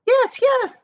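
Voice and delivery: high, loud eager cry; children crying out. A narrator's reading. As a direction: shout it out like excited kids. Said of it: "the children cried".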